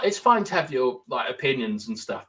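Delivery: normal talking voice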